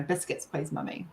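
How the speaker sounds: imitating a softly spoken child's request; quiet child. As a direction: ask very softly, as the child would. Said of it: "really quietly".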